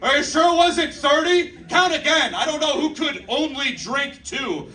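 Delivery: very high, calling across to a coworker; hammed-up high holler; mock yell.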